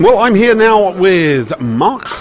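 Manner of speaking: normal talking voice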